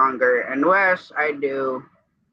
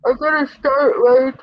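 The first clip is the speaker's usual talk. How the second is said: yawning; 11 semitones higher, 6 dB louder than usual. Real, not acted. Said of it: "I'm not yawning at all".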